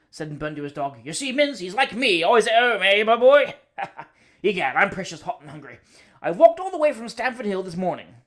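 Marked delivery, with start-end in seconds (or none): 1.0-8.1 hearty greeting, much louder; top of his voice. Acted. Cousin bursts in on the bachelor, booming at full voice. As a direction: boom the greeting across the room. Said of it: "He always spoke at the top of his voice".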